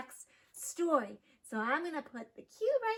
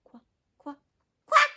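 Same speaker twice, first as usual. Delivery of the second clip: short duck calls, gently then loudly; duck quack; 13 semitones higher, 12 dB louder than usual. Acted. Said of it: "And a duck says".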